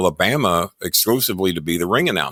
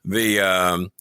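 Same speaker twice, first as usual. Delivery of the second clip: ordinary talk, nothing marked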